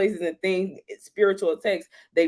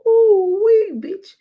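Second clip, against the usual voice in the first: very high, loud whoop; whooping exclamation; 8 dB louder, much slower than usual. Retold or imitated. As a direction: let out a shrieking ooh wee. Exclaiming at own state.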